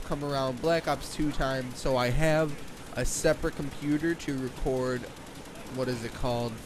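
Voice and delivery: ordinary talk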